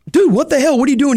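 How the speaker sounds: snapping back at a shove, hammed up; retold angry outburst. Telling a stranger who shoved him: mock angry yell.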